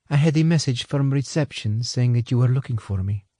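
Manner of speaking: a hint of a polite English woman; Anna. A narrator's reading. Anna explaining to Johnson, lightly voiced.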